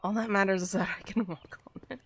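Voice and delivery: hushed, breathy repeat to herself; a mock-reassuring whisper to herself. Real, not acted. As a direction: whisper it like a secret consolation. Repeats the line as a hushed aside.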